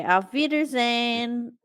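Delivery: playful German. Pretend. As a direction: sing out a German goodbye, slowly. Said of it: "all I can think of is German".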